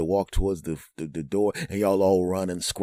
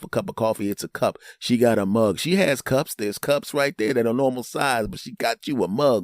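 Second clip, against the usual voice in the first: ordinary delivery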